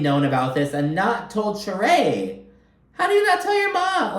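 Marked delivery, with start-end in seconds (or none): 2.9-4.2 very high, squealing disbelief; incredulous. Real, not acted. Vlogger asking the kids in shocked disbelief, pitched up high. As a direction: shriek the question in disbelief. Outraged rhetorical question.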